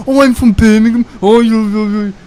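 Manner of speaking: high, loud Brummie viewer voice; mock Birmingham accent. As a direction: imitate an indignant Brummie commenter. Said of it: "gonna get a nasty comment from someone saying".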